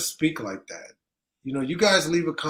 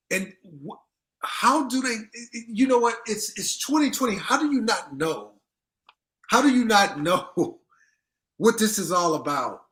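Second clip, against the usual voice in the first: incredulous rant; 6 semitones higher than usual. Real, not acted. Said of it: "ranting in disbelief".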